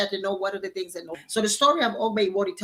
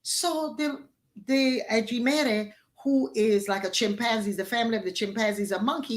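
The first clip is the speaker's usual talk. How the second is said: ordinary delivery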